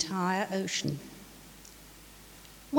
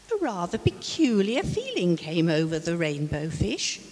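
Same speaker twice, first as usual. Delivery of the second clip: usual speaking voice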